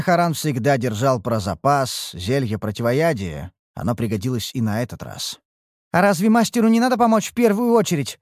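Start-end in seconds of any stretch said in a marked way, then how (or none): 5.9-8.2 lightly voiced little sorceress, higher, quick question; little sorceress asking. A narrator's reading. Little sorceress asks about helping the master first, lightly voiced questioning. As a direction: ask quickly, pitched up, a hint of a young girl mage. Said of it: "вопросила маленькая чародейка".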